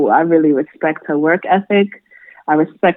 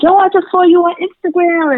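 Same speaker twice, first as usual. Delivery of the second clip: excited classmate; 11 semitones higher, 3 dB louder than usual. Retold or imitated. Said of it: "my classmates being like".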